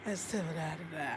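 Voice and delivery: soft, low imitation; imitating his talk. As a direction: drop to a low mumble-free murmur, imitating him. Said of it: "the way he talks to you".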